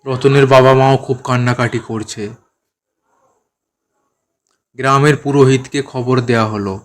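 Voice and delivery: ordinary delivery, nothing marked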